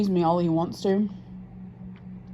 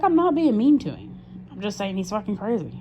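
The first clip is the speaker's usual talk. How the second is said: exasperated retort; 5 semitones higher than usual. Retold or imitated. Retold quarrel with boyfriend.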